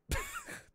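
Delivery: squeaky burst of laughter; high laugh. Real, not acted. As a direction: laugh at yourself. Laughing at own slip.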